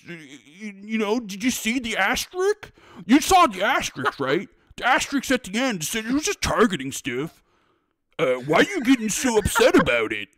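mock a whiny cartoon kid defending the asterisk; South Park character. Host mocking Xbox defenders in a South Park-style voice. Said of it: "you sounded like a South Park character".

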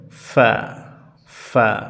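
ফা with air pushed out between the lips; lip air sound. Shown as a demonstration. Teacher demonstrating ফা as air passes the lips. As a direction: blow ফা through the lips twice. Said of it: "দুই ঠোঁটের মাঝখান দিয়ে বাতাস বের হবে".

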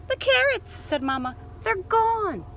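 high, shocked gasp; surprised Mama. Acted. Mama finding the carrots gone, high and shocked. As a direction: discover the empty cupboard aghast.

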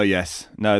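usual speaking voice

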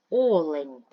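Олень with a loud, long opening О; stress on first syllable. Shown as a demonstration. Teacher sorting words by stress, first-syllable stress on Олень. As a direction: punch the first vowel of Олень. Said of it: "Перший".

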